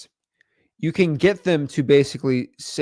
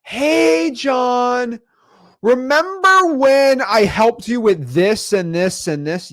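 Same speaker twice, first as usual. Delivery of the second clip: sing-song spouse calling out, much higher, slow; guilt-tripping spouse; 6 semitones higher, much slower than usual. Retold or imitated. Spouse reminding John of past favors, a sweet guilt trip. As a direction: call his name sweetly, then list the favors. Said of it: "it's usually this".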